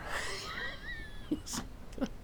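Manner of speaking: high, soft wheezy giggle; quiet helpless laughter. Real, not acted. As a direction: crack up at your own joke. Cracks up at own joke.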